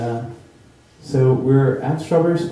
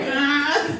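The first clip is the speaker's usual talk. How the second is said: high, shrill cry of fear; panicked kid's yelp. Retold or imitated. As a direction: yelp like a kid caught in the escalator. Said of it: "freaking out".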